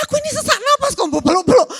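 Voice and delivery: high, loud, fast breathless complaint; whiny complaint. Acted. Sick person whining at being hugged, high and loud. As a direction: play someone gasping they can't breathe.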